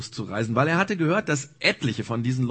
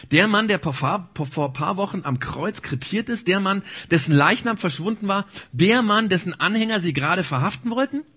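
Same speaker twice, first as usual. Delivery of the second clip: shocked disbelief; 6 semitones higher, 3 dB louder than usual. Acted.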